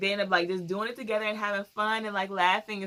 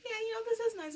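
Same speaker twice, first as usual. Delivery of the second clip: high mock voice; 5 dB quieter than usual. Imitating Molly's reply.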